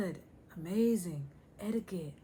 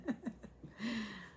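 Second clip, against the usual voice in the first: real giggle, somewhat softer; laugh; 2 semitones higher, 6 dB quieter than usual. Real, not acted. Host tickled by an accidental rhyme, laughing. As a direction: laugh at your own silliness.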